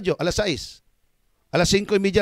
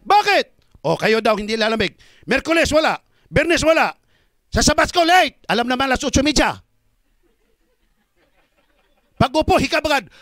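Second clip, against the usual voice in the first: high, loud scolding sermon; rising loud rebuke of late, yawning members; preaching at full force; 6 semitones higher, 4 dB louder than usual. Acted. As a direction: call out the lazy churchgoers. Preacher scolds lax churchgoers.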